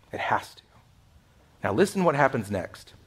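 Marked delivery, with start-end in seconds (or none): 0.1-0.6 breathy whispered insistence. Real, not acted. Pastor pressing the congregation, whispered. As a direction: whisper it as the point that matters.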